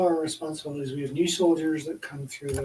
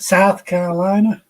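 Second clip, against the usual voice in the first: put-on South Carolina accent; 4 semitones higher, 11 dB louder than usual. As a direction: imitate a thick Carolina accent. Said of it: "his accent was so pronounced".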